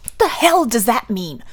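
angry. Acted. Threatening outburst at Tambi.

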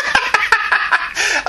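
squeaky burst of laughter; high breathy giggle. Real, not acted. Laughing at grandad.

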